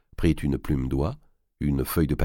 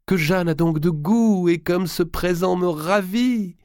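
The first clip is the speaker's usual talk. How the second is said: much higher and louder, slow showy praise; loud feigned delight; 13 semitones higher, 5 dB louder, slower than usual. A narrator's reading. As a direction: perform gratitude for the listening widow.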